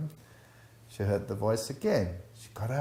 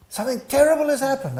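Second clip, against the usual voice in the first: higher, louder, fast alarmed blurt; panicked friend; 13 semitones higher, 6 dB louder, faster than usual. Retold or imitated. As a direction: rush it out like bad news just hit. Friend phoning in a total flap.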